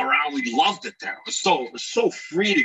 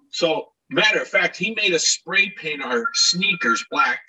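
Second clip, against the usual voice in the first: normal talking voice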